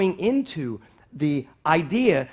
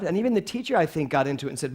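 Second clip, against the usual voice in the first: ordinary talk, nothing marked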